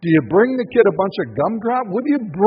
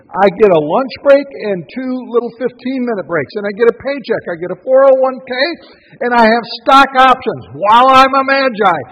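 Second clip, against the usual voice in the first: louder, boasting office worker; mock worker; 4 semitones higher, 8 dB louder than usual. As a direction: proudly list your job perks. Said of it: "are you gonna make a journey".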